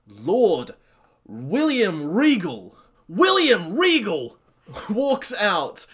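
loud, high, slow announcer-like hype; hyped entrance. Real, not acted. Fan announcing legend's surprise entrance, loud and slow. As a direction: call his name like the arena erupting.

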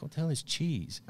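high, baffled what-the-hell; baffled question. Retold or imitated. Asking his dealer what cheese is, high and baffled. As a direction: sound truly puzzled by the word cheese.

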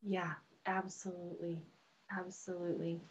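breathy hushed agreement; whisper. Real, not acted. Soft agreement in interview.

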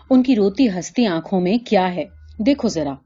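usual speaking voice

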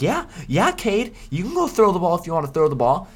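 put-on high, condescending baby-talk to a player; mocking coach voice. Coach teasing his quarterback in a high sing-song voice. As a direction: coax him like a little kid, way up high. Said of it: "Josh will be like".

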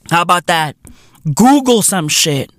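normal talking voice

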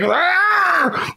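high mock yelp of Arnold; forced strained cry. Imitating Arnold straining to get his accent out, forced cry. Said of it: "forced to speak in that accent".

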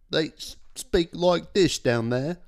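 a lightly put-on South African accent, slow; mock a South African accent badly. Joking to his cohost about South Africans, a bad South African accent. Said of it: "That was a British accent?".

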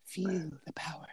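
breathy villain whisper; whispered menace. Retold or imitated. Quoting Yzma handing poison to Kronk, a menacing whisper. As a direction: hiss it like a dark secret.

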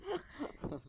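high laugh. Real, not acted. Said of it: "laughing at granny voice".

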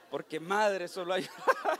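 chuckling through the words; laughing. Real, not acted. Preacher laughing at his own joke, chuckling. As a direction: laugh while explaining the punchline.

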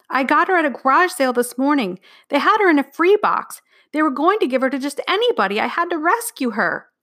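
put-on high-pitched, rushed-out child's excuse; pleading. A narrator's reading. Girl explains the cat to staring parents, pleading. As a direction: plead your case in a high voice.

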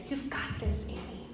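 breathy hushed whisper. Pretend. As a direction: breathe it close to her ear. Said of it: "he's whispering".

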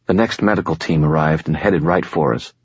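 plain reading voice

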